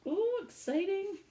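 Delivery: ordinary delivery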